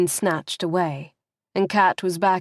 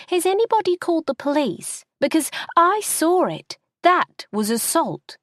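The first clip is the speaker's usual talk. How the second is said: high, fast indignant stranger; outraged bystander; 11 semitones higher than usual. Acted. A stranger in the crowd calls for police, high and indignant. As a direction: demand the police, scandalised.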